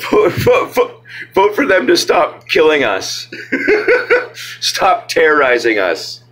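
laughing through the words, voice jumping high; half-laughing mock rally cry. Real, not acted. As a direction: chant vote while cracking up. Laughing mock cheer to vote.

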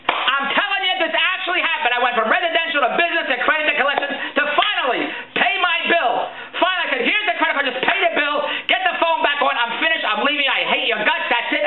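high-pitched, insistent tirade; fed-up rant. Retold or imitated. Caller finally paying collections, fed up and ranting. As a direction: pour it out like someone at the end of their rope. Worked-up retelling of final outburst.

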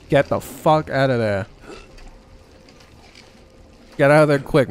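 loud Boston accent slipping out. Real, not acted. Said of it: "My Boston accent's coming out".

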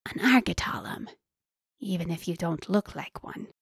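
whispery hiss from a beast; menacing creature hiss. A narrator's reading. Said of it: "before he hissed".

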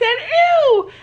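sharp high yelp of disgust; mock-disgusted squeal. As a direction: recoil with a loud ew. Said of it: "And I said".

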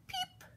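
tiny, soft, very high peep; chick peep. A narrator's reading. Chick follows its mother, tiny high peep. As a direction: peep softly like a baby chick. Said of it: "her chick said".

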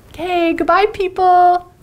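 bright, loud sign-off; cheery goodbye. Real, not acted. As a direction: sing out the goodbye pun. Punning sign-off to class.

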